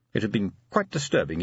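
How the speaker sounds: plain reading voice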